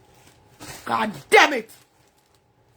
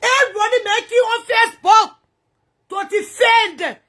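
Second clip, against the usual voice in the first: crying out; 7 semitones higher, 3 dB louder than usual. Real, not acted. Crying rant at critic.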